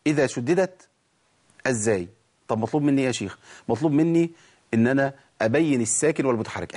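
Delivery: normal talking voice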